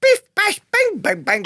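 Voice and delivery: high, punchy bang-bang noises; mock battle noises. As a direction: shout the comic sound effects. Sound effects of ships.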